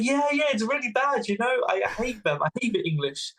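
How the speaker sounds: high-pitched, over-keen agreement, settling down; eager agreement. Retold or imitated. Traveller winning over Taliban commandos, eagerly agreeing. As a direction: play up the shared grievance, starting high then easing.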